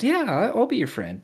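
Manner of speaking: high, eager mock voice; mock nice guy. Reading the guy's reply, high mock voice. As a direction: chirp it up like him. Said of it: "He should have just stopped".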